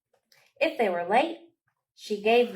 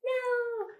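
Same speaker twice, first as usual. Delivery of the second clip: squeaky high-pitched no, much higher; sudden high no. Acted. Librarian scolding the puppet that hops down too early, high surprised yelp.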